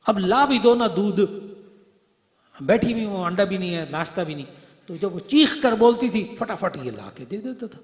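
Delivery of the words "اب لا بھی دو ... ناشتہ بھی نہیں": high, loud scolding yell; mother's shout. Retold or imitated. Preacher imitates a mother yelling at her husband, high angry shout. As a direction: nag him loudly like a fed-up wife. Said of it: "یہ چیخ کر بولتی تھی ماں".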